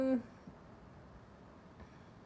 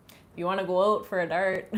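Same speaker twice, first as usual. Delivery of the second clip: louder, hammed-up Canadian accent; real Canadian accent; 5 dB louder than usual. Shown as a demonstration. Streamer demonstrating for chat, strong Canadian accent. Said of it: "that's, like, a real Canadian accent".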